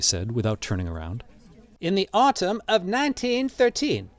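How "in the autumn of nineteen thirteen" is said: high-pitched character answer; old man Drioli. A narrator's reading. Old man answering the crowd, high character voice. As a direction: lift the voice far above narration.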